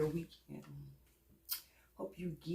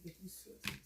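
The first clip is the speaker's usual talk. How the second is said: whispering; 15 dB quieter than usual. Real, not acted. Mutters to herself mid-task.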